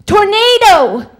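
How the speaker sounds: loud, high, stretched-out warning yell; mock alarm shout. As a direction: holler a false alarm, dragging the word out. [name] copies the shouted trick.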